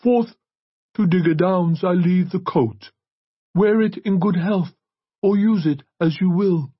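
high, loud, slow proclamation; priest reading a will. A narrator's reading. Priest reads Chrysalis's will to the church, solemn proclamation.

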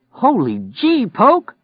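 high-pitched, startled holler. Acted. Robber to his partner after the killing, hollering. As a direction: holler it loudly at your partner. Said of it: "Lloyd hollered".

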